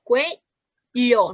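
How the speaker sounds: "cuello" as cue, then llo; two-beat "cuello"; split into syllables. Shown as a demonstration. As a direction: gap before the last syllable of "cuello". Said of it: "solo repite".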